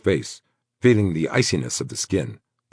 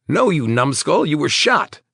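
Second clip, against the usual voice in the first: exasperated friend; 11 semitones higher, 5 dB louder than usual. A narrator's reading. Friend scolding wounded man.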